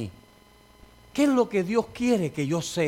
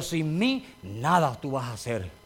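a low, cold imitation of the elder; elder's put-down; 5 semitones lower than usual. Senior elder cursing the pastor as he leaves, low and cold. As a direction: sneer it down at him like the senior elder. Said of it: "Me dijo".

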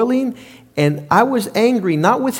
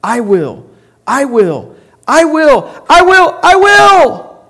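from plain to shouted, rising much higher and louder; the devil's boast building to a roar; 4 semitones higher, 7 dB louder, slower than usual. Acted. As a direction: swell each "I will" into a proud shout. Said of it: "the devil's character is".

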